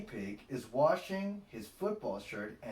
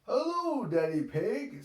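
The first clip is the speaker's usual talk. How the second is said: higher, louder group hello; friends greeting; 11 semitones higher, 6 dB louder than usual. A narrator's reading. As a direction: greet Daddy Pig cheerfully as the team. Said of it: "says all his friends".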